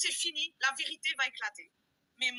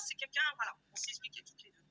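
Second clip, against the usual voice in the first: ordinary delivery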